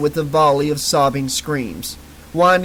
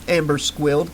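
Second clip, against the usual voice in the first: plain reading voice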